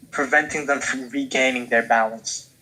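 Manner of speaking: usual speaking voice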